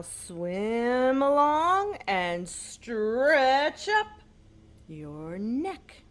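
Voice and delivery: slow, loud stretching call; drawn-out stretch. Acted. Telling children to stretch like a swan, slow and loud. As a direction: stretch the words out like a long neck.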